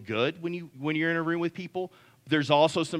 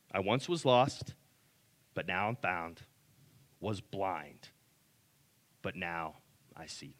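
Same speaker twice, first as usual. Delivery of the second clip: fading to almost nothing, softer line by line; quiet hymn recitation; 9 semitones lower, 9 dB quieter than usual. Acted. Pastor ending the sermon on Amazing Grace, fading softly. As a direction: hush down into the last words.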